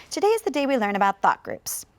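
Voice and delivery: whole sentence in one breath, a bit quick; no pauses. Shown as a demonstration. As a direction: run it straight through without stopping. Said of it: "I can read the whole thing all the way through without a pause".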